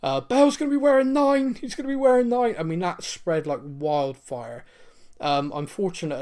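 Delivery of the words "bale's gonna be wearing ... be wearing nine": excited. Retold or imitated. Excited fans decoding the clue.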